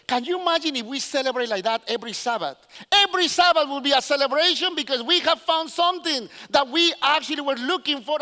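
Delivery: ordinary talk, nothing marked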